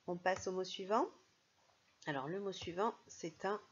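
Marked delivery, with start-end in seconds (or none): none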